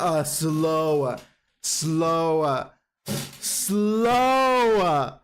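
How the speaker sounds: "slow" stretched longer each time; drawn-out SLOW. Shown as a demonstration. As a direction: drag out the word "slow", repeating it. Draws out slow to illustrate it.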